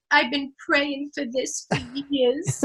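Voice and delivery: put-on slow, drawn-out old grandma; grandmother's voice. Ninety-year-old grandmother rejoicing at the news, slow and heartfelt. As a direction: imitate a sassy old grandmother, slowly. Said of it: "my grandmother goes".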